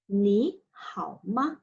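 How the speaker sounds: "Ni hao ma" said slowly and clearly for learners; slow model phrase. Shown as a demonstration. Teacher modelling a greeting slowly for the class. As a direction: spell out "Ni hao ma" at half speed. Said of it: "you're going to ask him".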